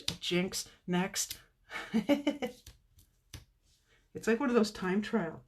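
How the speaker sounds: usual speaking voice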